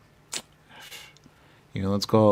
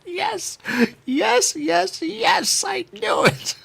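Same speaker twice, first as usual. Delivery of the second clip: high, loud inner cheer of triumph; a part of me going yes, yes, I knew it; gleeful cheer; 4 dB louder, much slower than usual. Retold or imitated. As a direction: cheer it like a secret victory. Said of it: "a part of me going".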